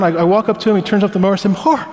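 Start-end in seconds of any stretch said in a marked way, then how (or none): 1.5-1.9 high-pitched, grateful gush; retold delighted thanks, voice way up. Grateful man to the neighbor mowing his lawn, gushing in a high voice.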